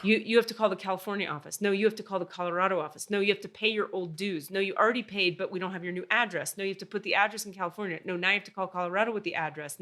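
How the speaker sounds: fast, monotonous imitation of bureaucrats; rattled-off runaround. Call center passing a caller around, rattled off. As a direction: reel off one instruction after another, quickly. Retold runaround between departments.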